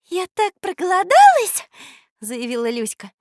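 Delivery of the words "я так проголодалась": put-on high, loud girlish whine; eager little girl. A narrator's reading. Girl answering the offer of food, eager. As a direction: exclaim hungrily in a high voice.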